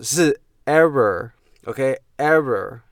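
"error" said slowly and carefully with a clear e sound; correct error. Shown as a demonstration. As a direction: model "error" slowly for learners to copy.

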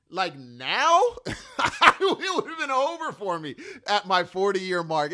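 real laugh while admitting he'd cry. Real, not acted. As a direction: chuckle it out, amused at himself. Laughs about being emotional now.

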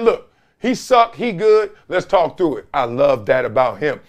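usual speaking voice

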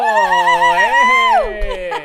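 "¡Eh!" agudo y fuerte, de fiesta; grito de celebración. Real, not acted. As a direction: celebra la llegada de la invitada. Presenta a la invitada. (presentador recibe a Gisette con un grito alegre)

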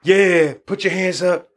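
lightly voiced hype man working the crowd; hype DJ on the mic. DJ recalls calling to the dance floor, mild mock hype. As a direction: call out to the crowd like a club DJ, half-performed. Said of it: "you're on the microphone".

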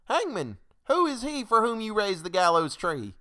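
high, loud challenge from the crowd; high-pitched demanding cry. A narrator's reading. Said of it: "till one cried".

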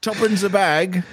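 high, slow Mary Poppins Cockney; mock Cockney. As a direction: croon it like a Cockney street seller.

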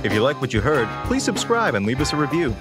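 normal talking voice

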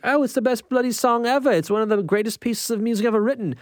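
imitating Jack's earnest British praise; British accent. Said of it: "And he's like".

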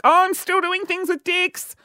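very high, hammed-up boast; slow, high-pitched self-parody; mock overcompensating. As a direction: send yourself up in a squeaky-high voice. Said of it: "do you like how I overcompensate".

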